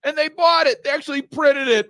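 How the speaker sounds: high, loud mock triumph; hammed-up villain gloat; gloating delight. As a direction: crow over the suckers.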